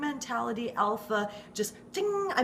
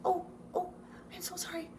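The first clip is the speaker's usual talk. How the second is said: high, soft, repeated apologies; apologetic old neighbor; 7 semitones higher, 9 dB quieter, faster than usual. Retold or imitated. Elderly neighbor at the fence apologizing over and over, high and soft. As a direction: flutter out sorries like a flustered old lady. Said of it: "my neighbor's like".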